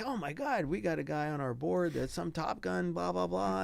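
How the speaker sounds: high, gushing mock excitement; a hammed-up thrill, pitched high; excited gush. Executive director raving about a pilot, high excited gush.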